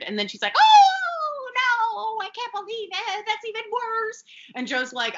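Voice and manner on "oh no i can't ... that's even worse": high, loud drawn-out wail; hammed-up horrified squeal, way up high; mock dismay. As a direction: wail it like melodramatic despair. Acting out her horrified reaction.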